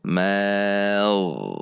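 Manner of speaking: "Mau" held, darkening as the tongue root rises; slowed tongue rise. Shown as a demonstration. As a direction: slide slowly from thin ma into ghain. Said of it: "masuk ke ghain yang isti'la". ("Mau" dilambatkan, pangkal lidah naik)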